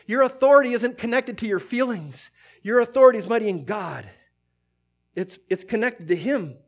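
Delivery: normal talking voice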